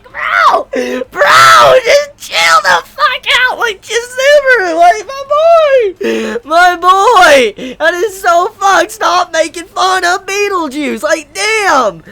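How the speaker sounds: high, loud, frantic yelling with a cry in it; exasperated shout. Retold or imitated. Fan yelling at Subaru to stop taunting Beetlejuice, frantic shout. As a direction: scream at him to just chill out. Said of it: "And I'm like".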